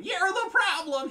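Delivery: very high, loud, drawn-out yell; mock whiny opponent. Player mimicking rival still going on, high loud mock whine. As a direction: mimic a ranting sore loser. Mocking opponent's ongoing rant.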